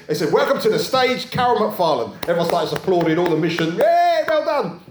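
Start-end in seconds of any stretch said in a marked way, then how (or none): 0.3-2.1 announcer's welcome. Retold or imitated. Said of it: "And they said". 3.8-4.8 high, slow, loud "Yay"; mock cheer. Mission crowd applauding the lady, hammed-up cheer.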